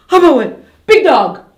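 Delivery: loud, high startled cry; excited exclamation. Shown as a demonstration. Teacher showing pupils an exclamation, loud and startled. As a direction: gasp it out at the sight of the dog. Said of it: "either scared or I'm excited".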